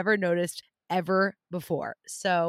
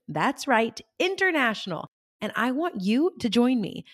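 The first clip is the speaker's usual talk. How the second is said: upbeat promo read with punched key words; lively, emphatic sales delivery; 3 semitones higher, 4 dB louder than usual. Acted. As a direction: pitch it like a podcast advert. Recorded retreat promo.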